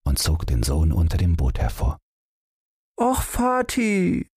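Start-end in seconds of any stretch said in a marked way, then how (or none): 3.0-4.3 put-on high, slow whiny child pout. Boy pulled out from his hiding place, high slow pouting whine. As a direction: drag it out like a sulking little boy. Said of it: "schmollte Tom".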